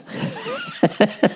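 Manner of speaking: high, wheezy laugh. Real, not acted. Comic amused by his own tale, laughing. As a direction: crack up at your own story.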